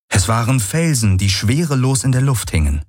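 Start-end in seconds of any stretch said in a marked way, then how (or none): none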